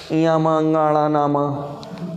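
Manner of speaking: इया, म, अंगा, अणा, ना, म slowly through the nose; level, nasal run of इया, म, अंगा, अणा, ना, म; nasal sounds demonstrated. Shown as a demonstration. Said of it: "नासिका से उच्चारण होता है".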